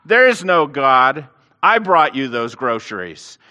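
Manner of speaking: loud gotcha, slowing on no God; spring out and crow over her; triumphant shout. Retold or imitated. Atheist jumping from the bushes, shouting in triumph. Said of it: "he shouted".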